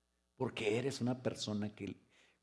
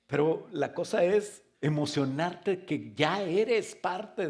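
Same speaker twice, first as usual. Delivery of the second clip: preaching at full force; 6 semitones higher, 5 dB louder than usual. Acted. Pastor urging his church, preaching at full force. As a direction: preach it out, lifting the congregation.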